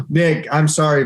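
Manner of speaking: ordinary talk, nothing marked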